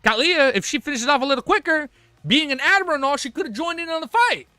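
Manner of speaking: high, loud mock griping; mock exasperation. Reader griping at Katlia for arriving late, mock exasperated. Mock complaint about a character's lateness.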